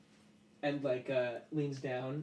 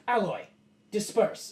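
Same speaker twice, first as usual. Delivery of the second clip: loud, raised-pitch order; sharp command; 7 semitones higher, 7 dB louder than usual. Acted. Master dismissing the metal creature, commanding. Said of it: "Lalus goes".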